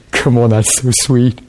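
ordinary talk, nothing marked

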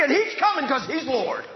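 usual speaking voice

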